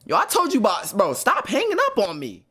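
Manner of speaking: high, loud complaint; annoyed shout. Real, not acted. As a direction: scold the friend for hanging up. Complains about being hung up on.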